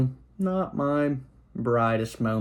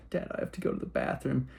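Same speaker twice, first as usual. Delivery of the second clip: fast, urgent whisper; scared whisper; 10 dB quieter, faster than usual. Retold or imitated. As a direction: whisper it quickly to dad. Scared kid quietly asks dad.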